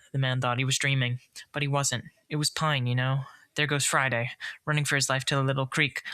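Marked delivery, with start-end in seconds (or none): none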